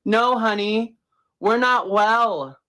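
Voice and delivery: ordinary delivery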